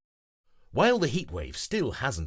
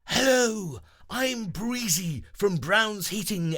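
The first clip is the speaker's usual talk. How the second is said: high, slow hero voice; Breezy yelling back; 11 semitones higher, 5 dB louder, slower than usual. Acted. Breezy introducing himself over the phone, yelling. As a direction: shout over the wind proudly. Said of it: "the voice yells back".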